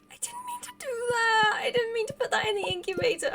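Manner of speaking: high, whiny wail of regret; wailing dismay. Real, not acted. Player lamenting an egg put in the incubator, wailing dismay. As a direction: wail it high, upset at your own slip. Mistake in the game.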